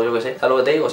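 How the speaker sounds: ordinary talk, nothing marked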